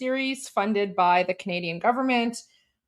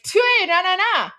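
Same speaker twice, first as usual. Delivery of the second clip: put-on proud child; 6 semitones higher, 4 dB louder than usual. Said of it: "I'm like".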